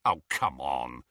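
low, slow scoff; drawn-out, dismissive groan of words. A narrator's reading. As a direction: dismiss it with weary disbelief. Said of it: "scoffed Ron".

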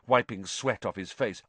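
ordinary delivery

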